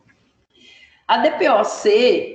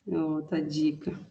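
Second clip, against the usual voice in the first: sympathetic; 5 semitones lower, 10 dB quieter than usual. Real, not acted. Teacher comforts sick student.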